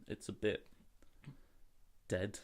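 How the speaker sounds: ordinary talk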